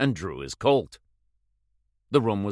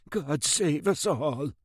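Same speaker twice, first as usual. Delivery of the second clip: put-on slow, higher old-man mutter under his breath; old man crossing himself, muttering; 6 semitones higher, slower than usual. A narrator's reading. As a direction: pray it fearfully to himself. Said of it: "crossed himself and muttered".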